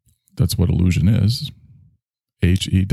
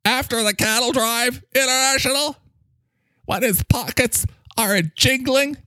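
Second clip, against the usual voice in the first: higher, alarmed herald of doom; high, dramatic character voice. Acted. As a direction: announce the critics' invasion in a high, theatrical voice. Character voice continues.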